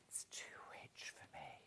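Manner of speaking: whispered confiding joke; whispered wry confession. Real, not acted. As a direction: let them in on a secret. Confiding aside about Liberty prices.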